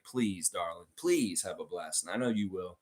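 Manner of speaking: soft, low, gentle plea; tender. Real, not acted. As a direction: wish a loved one well, hushed and low. Tender wish to Monique.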